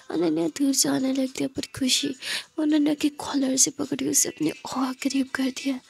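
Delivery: hushed, intimate story whisper; whispered narration. A narrator's reading. Narrator whispers the kiss scene, hushed and intimate. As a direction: breathe the romance softly to the listener.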